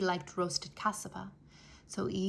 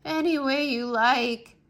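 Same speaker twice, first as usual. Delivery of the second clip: moan; 3 semitones higher, 6 dB louder than usual. A narrator's reading. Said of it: "Anansi moaned".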